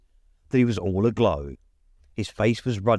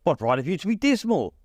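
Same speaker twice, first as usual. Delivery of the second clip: higher, bright playful comeback; 7 semitones higher than usual. A narrator's reading. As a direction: toss the question back cheerfully. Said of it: "returned the nephew gaily".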